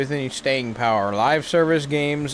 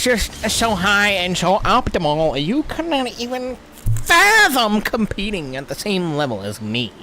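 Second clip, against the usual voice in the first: pompous voice; 6 semitones higher than usual. Pretend. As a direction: boast like royalty. Pompous boast mocking.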